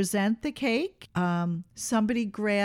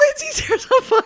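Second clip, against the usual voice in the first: high giggling delivery; giggling kids; 7 dB louder than usual. Retold or imitated. Mom imitating the party boys, high and giggling.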